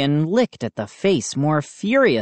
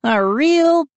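put-on sarcastic girl voice; 13 semitones higher, 4 dB louder than usual. A narrator's reading. Mindy starts insult.

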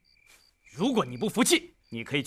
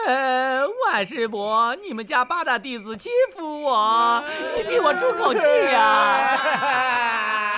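slow, drawn-out sarcastic whine, higher; mock whining; 6 semitones higher, 4 dB louder, much slower than usual. Bully mimicking the boy tattling to his father. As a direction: ham up a crybaby's complaint. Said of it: "不妨哭着去告诉我爹".

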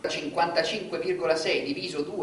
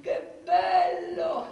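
ecstatic; 9 semitones higher, much slower than usual. Acted. Ecstatic high drawn-out exclamation.

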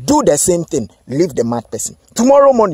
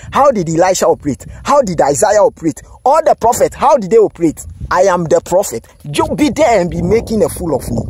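ordinary talk, nothing marked

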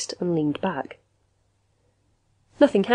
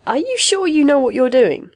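high, doubtful question; lightheaded doubt; 8 semitones higher, 5 dB louder than usual. A narrator's reading. Lightheaded patient doubts the machine, high and uneasy. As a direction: question it in a raised pitch. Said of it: "feeling lightheaded".

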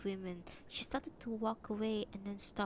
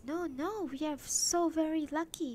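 a hint of an upbeat husband, higher and louder; Tilo voiced; 7 semitones higher, 6 dB louder than usual. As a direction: lightly imitate a cheery husband. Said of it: "And he says".

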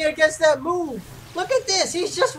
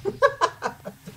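soft, high laugh; laughter; 4 semitones higher, 6 dB quieter than usual. Real, not acted. Gamer after a burst of rage, soft high laughter. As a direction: let a quiet, pitched-up laugh spill out.

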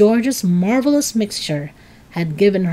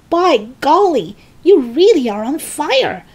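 higher-pitched astonished exclamation; amazed; 8 semitones higher, 4 dB louder than usual. A narrator's reading. George seeing smoke from Grandma, amazed. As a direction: marvel at it in a raised voice.

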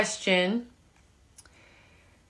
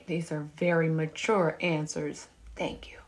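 soft hushed whisper; 4 semitones lower, 7 dB quieter than usual. Real, not acted. Whispering to live viewers about mature answers. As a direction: lean in and whisper it.

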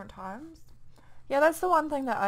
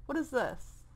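ordinary delivery

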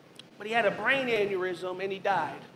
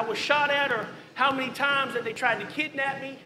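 ordinary delivery

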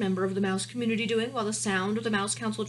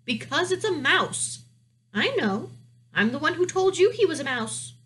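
high, louder princess voice; protesting princess; 7 semitones higher, 5 dB louder than usual. Acted. Princess argues with her father over the mouse, high and insistent.

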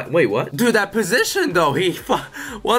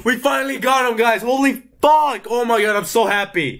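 thrilled shouting; 8 semitones higher than usual. Real, not acted. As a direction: yell with pure joy at the win. Finally unlocking the wanted character.

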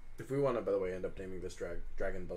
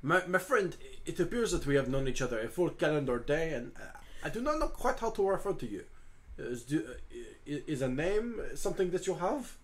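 put-on courteous French-accented character, feeling for words; Hugo, politely hesitant; 4 semitones higher than usual. Hugo asks the dragon its name, hesitant French accent. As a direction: ask delicately, as a polite Frenchman.

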